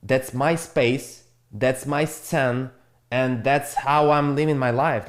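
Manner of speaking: slowed right down, each word placed; slow, firm statement of ownership; slow and deliberate. Real, not acted. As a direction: lay it down slowly, claiming your turf. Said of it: "I don't give a fuck".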